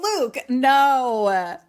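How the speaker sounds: long, loud wail of disapproval; mock dismayed scolding. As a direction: refuse him slowly, stretching each word. Scolding a boy's kiss attempt.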